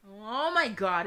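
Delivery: loud, high, slow oh my God; astonished gasp. Real, not acted. Player seeing the character's face change, astonished exclamation. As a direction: stretch it out in amazement.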